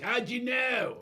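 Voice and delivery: high, slow astonished shout; surprised shout. Retold or imitated. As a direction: shout it in disbelief. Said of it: "He goes".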